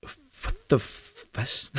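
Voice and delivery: stunned near-whisper, trailing off. A narrator's reading. All stunned by the professor's question, hushed disbelief. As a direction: let it slip out under the breath, dumbfounded.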